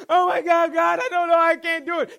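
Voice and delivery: loud, high mock-desperate cry; panicked plea. Struggling believer crying to God under temptation, hammed-up panic.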